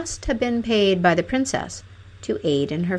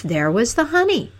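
usual speaking voice

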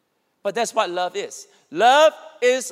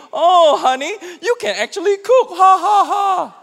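high, loud sarcastic laughing jab; husband teasing his wife in front of guests, loud mocking laughter; 8 semitones higher, 6 dB louder than usual. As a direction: laugh at her cooking in front of friends.

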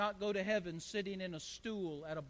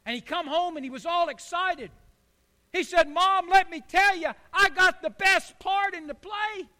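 much higher and louder, bursting with excitement; little boy bursting with news; excited boy; 9 semitones higher, 13 dB louder than usual. Acted. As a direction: tell it like a thrilled kid.

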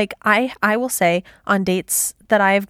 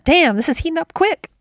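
a higher, knowing Donna line; Donna quote; 10 semitones higher than usual. Retold or imitated. Quoting Donna to her co-host, a hint of her sass. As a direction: savor it like the line is getting hot. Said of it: "Donna, Donna, Donna, Donnitude".

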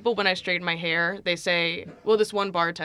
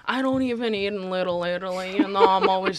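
lightly put-on comedian's voice; mimicked comic mispronouncing. Guest quotes the comic's bit, lightly mimicked voice. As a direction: imitate him, landing on an odd Chipotle. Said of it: "He says it wrong".

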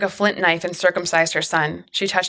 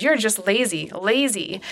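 higher, swooping scolding, not truly shouted; mild narrated shout; 3 semitones higher, slower than usual. A narrator's reading. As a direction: scold like an exasperated ruler, without raising the volume. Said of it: "But Pharaoh shouted".

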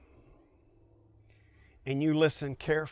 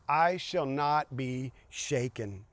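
ordinary delivery

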